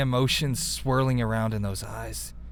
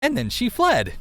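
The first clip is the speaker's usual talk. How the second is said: "And then she fled" pitched up, a bit louder, sing-song; odd read; 10 semitones higher, 3 dB louder than usual. Shown as a demonstration. Reader reads the line in a strange, lilting way. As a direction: read "fled" high and dramatic, by accident. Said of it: "I don't know why I read it like that".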